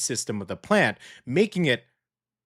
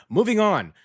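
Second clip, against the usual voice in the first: upbeat transition; 8 semitones higher, 5 dB louder than usual. Real, not acted. Transition announcement.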